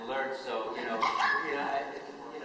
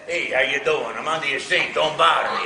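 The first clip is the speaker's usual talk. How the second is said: Brooklyn plumber; 3 semitones higher, 11 dB louder than usual. Retold or imitated. Auditioning as a Brooklyn plumber, loud gruff accent. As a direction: shout it like a Brooklyn plumber stuck under a sink. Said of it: "Italian plumber from Brooklyn".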